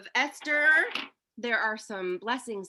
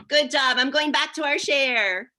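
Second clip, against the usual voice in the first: usual speaking voice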